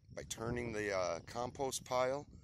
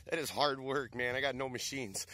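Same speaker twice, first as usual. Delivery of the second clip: louder, higher breathless complaint; out of breath; 4 semitones higher, 4 dB louder than usual. Real, not acted. Worn-out gardener complaining to viewers, out of breath. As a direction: push the words out between gasps. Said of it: "man, I'm winded".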